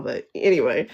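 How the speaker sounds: ordinary delivery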